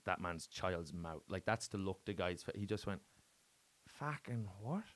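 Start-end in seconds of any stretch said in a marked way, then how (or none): 3.9-4.8 much softer Aussie impression; put-on Australian accent. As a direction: imitate a stunned Australian, very quietly. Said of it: "I'm doing this Australian accent".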